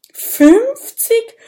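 very high, loud aghast cry; dismayed shout. A narrator's reading. Minimo hears fifty of him are needed, aghast. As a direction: blurt it out in alarm. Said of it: "ruft Minimo bestürzt".

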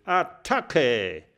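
Attacke! höher, fremde Stimme, kräftig gerufen; Attacke! als Befehl des Anführers; ruft. Acted. (Attacke! gerufen, etwas lauter)

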